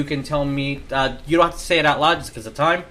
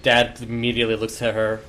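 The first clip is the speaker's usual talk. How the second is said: normal talking voice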